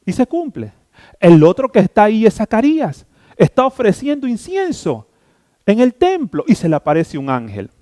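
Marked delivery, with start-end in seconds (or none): none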